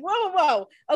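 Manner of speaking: high-pitched backpedaling whoas; mock hurried interruption. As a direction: imitate someone heading off trouble. Mom hurriedly interrupts, retold.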